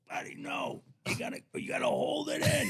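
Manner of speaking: high, strained voice on held breath; imitated smoke-holding. Hippie coaching a teen to hold it in, imitated choked voice. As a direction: squeeze the words out without exhaling.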